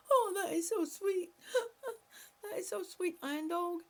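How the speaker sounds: high laughing delight; giggling "so sweet"; laughing, touched. Real, not acted. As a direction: melt at the sweet comment. Touched by viewer's comment.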